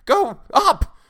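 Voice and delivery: very high, loud shrieked directions; hysterical. A narrator's reading. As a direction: shriek him away in panic, slowing on the last words. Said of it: "she cried hysterically".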